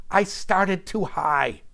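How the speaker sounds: loud, high-pitched quoted rant; worked-up retelling in someone else's voice; Roseanne Barr. Acted. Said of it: "Miz Barr fondly recalled".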